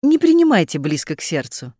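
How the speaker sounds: ordinary delivery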